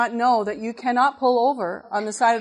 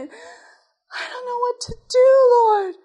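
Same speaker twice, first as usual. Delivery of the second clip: much higher, slower, crying plea to God; crying prayer; 14 semitones higher, 5 dB louder, slower than usual. Retold or imitated. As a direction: alone in the truck, crying out to the Lord in despair. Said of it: "I cried and I cried".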